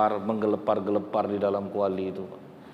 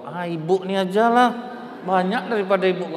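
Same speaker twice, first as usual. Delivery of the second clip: higher, louder and fast, delighted; a woman bowled over by cash, high and loud; eager acceptance; 8 semitones higher, 5 dB louder than usual. Acted. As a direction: gush over the bigger gift. Woman grabbing the bigger gift eagerly.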